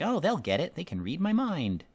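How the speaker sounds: high, bright self-mocking voice; mock naive confidence. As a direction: play your own clueless optimism. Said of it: "I'm just like".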